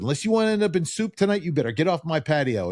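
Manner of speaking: half-strength comic yell; retold yell. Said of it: "I yelled at him".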